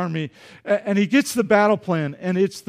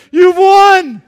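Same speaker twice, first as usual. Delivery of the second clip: high, loud, grand call; mock referee announcement; 10 semitones higher, 12 dB louder than usual. Pastor joking a referee hands the Browns a win, loud mock call.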